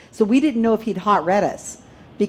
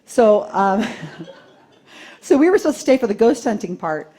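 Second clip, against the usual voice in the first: ordinary delivery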